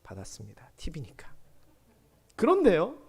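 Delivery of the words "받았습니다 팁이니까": much lower, whispered admission; sheepish whisper. Real, not acted. Preacher admitting to the congregation he took the tip, whispered. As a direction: confess it with a wink.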